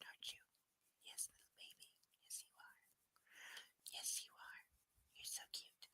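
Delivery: hushed whisper to a sleepy pet; gentle bedtime whisper to a drowsy pet; soft whisper. Real, not acted. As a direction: whisper softly, lulling it to sleep.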